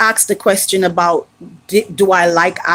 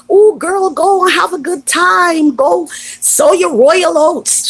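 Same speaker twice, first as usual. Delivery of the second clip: put-on high, loud, gushing girlfriend voice; imagined cheer; 11 semitones higher, 3 dB louder than usual. Friend cheering her on to have fun in Africa, high and gushing. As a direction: gush it like a hyped-up friend. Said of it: "I wanted to hear".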